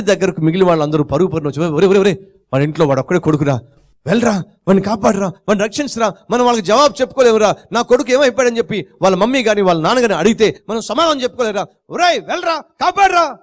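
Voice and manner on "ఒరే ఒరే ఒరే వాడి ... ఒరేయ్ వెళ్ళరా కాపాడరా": fast, building higher to a frantic cry; panicked pleading. Acted. Friends begging the swimmer to save the boy, frantic. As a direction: beg faster and higher until it breaks into a plea.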